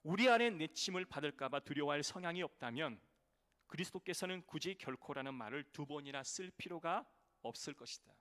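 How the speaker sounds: loud emphatic quotation; quoting a theologian. Acted. Pastor quoting a theologian to the congregation, loud. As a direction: declaim the quote with force. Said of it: "신학자는 이렇게 말합니다".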